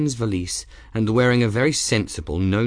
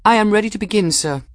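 put-on high, louder young woman's voice; Bridget, resolute; 12 semitones higher, 5 dB louder, faster than usual. A narrator's reading. Young woman to master of arms before duel, resolute. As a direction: report ready, formal and high. Bridget declares herself ready.

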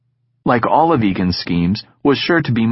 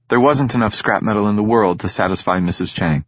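ordinary delivery

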